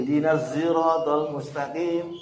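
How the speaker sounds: "siratal" read slowly with a Javanese-coloured "irotol"; slow recitation in the local dialect. Shown as a demonstration. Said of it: "seperti itu dialeknya".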